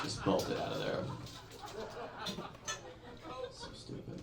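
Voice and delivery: breathy laughed aside; whisper-laugh it. Real, not acted.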